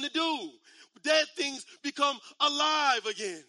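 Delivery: climbing into a thundering sermon climax; full-force preaching. Acted. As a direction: preach it, rising to a cry of triumph.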